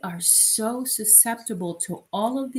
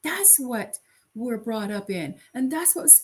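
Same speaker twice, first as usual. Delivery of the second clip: normal talking voice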